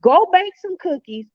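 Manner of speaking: urging. Retold or imitated. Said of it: "he was like, basically".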